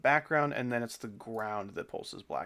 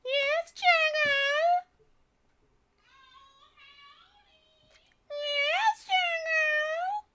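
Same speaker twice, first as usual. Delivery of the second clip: long high call; 6 dB louder, much slower than usual. Real, not acted. Calling out to Chagall.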